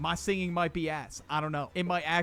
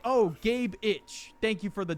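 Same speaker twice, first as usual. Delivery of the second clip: slightly hammed-up streamer thank-you, higher pitched; mock donation shout-out, pitched up; streamer reading donations; 4 semitones higher, 3 dB louder than usual.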